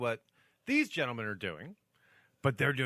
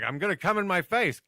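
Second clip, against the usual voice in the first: loud, high-pitched comic quote; mock exclamation; 6 semitones higher, 7 dB louder than usual. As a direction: blurt it out in a raised, hammed-up voice. Loudly voices a crude mock quote.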